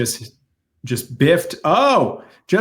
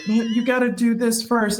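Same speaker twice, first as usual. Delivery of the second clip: high-pitched imitation of pleading kids; insistent grown child; 10 semitones higher than usual. Kids prodding their dying dad on camera, high insistent voice. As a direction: mimic kids urging Dad to hold on. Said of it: "they were like".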